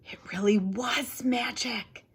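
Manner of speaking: whispered in wonder; hushed, amazed aside; awed whisper. A narrator's reading. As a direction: breathe it like a secret. Awed secret aside in narration.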